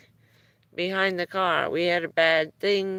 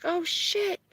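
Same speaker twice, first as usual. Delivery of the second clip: surprised oh shit; 12 semitones higher than usual. Retold or imitated. Retold son seeing runaway trailer.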